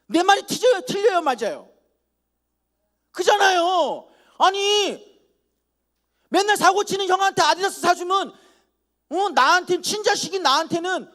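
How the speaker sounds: loud, high protest; indignant shout. Retold or imitated. Son pleading his case to the congregation, loud and indignant. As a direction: shout it like a wronged teenager.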